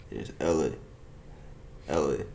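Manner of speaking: "Ellie" said low and gruff, lightly voiced; a faint imitation of a weary father calling "Ellie"; Joel from The Last of Us. Shown as a demonstration. Showing a friend his Joel impression, a low lightly voiced "Ellie". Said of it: "that's how you say Ellie".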